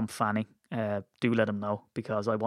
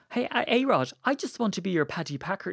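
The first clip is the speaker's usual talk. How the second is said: much higher put-on voice, overplayed; hammed-up Paddy; 7 semitones higher than usual. Shown as a demonstration. Begging A-Rod to take him on, in a hammed-up voice. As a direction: camp it up as the token Irish fan. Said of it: "it's not like".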